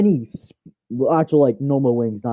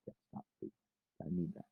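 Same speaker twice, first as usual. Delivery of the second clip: breathless whispered begging; hush-plead with the cat; real whisper to the cat; whispered plea; 7 semitones lower, 22 dB quieter, faster than usual. Real, not acted.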